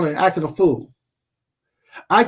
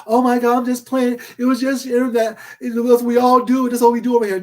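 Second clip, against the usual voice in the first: mock whiny excuses, higher; mocking backpedaler; 5 semitones higher than usual. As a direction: imitate someone pretending it was a joke, hammed-up. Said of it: "It's not gonna be all this".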